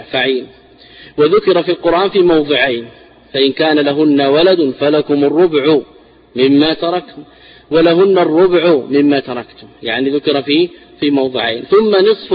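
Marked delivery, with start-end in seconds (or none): none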